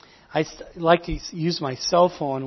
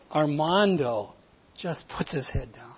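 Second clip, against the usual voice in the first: usual speaking voice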